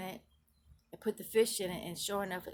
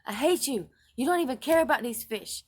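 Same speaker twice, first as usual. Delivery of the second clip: loud, bitter shouting; louder furious accusation; angry outburst; 5 semitones higher, 9 dB louder than usual. Retold or imitated. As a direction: hurl the words at him in rage. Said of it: "a very strong word to him".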